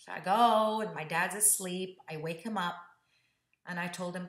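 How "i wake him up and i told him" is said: normal talking voice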